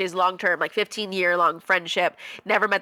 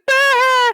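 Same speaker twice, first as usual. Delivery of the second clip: very high, loud shriek; imitated excited scream; 9 dB louder than usual. Friend on the phone hears about the engagement, imitated scream. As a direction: shriek like a friend hearing big news. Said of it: "She's going".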